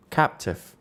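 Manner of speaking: normal talking voice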